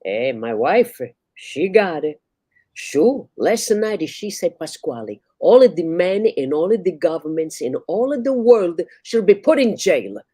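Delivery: Italian barber, worked up; loud Italian. Acted. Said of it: "like a loud Italian".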